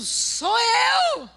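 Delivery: mock boastful shout; proud shout. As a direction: belt it like a proud young preacher. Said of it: "ele subiu dizendo".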